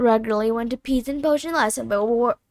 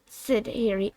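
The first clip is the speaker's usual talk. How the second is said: plain reading voice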